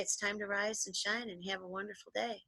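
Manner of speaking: mother's soft, loving wake-up call; gentle wake-up voice. Retold or imitated. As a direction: coax a sleepy child awake softly. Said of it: "And she'd say".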